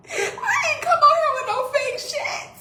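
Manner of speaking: high, tearful, half laughing through it; pleading through tears to be believed; crying. Real, not acted. Crying over husband's betrayal.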